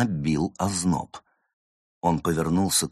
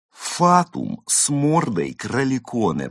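moaning; 6 semitones higher than usual. Acted. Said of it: "простонал кот".

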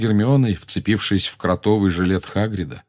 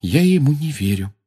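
higher-pitched, barely controlled disbelief; unsteady disbelief; 6 semitones higher than usual. A narrator's reading. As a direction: say it as if the voice won't quite obey. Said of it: "Голос ещё плохо повиновался ей". (голос плохо слушается)

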